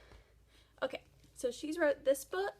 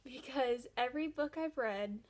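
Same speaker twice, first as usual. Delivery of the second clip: usual speaking voice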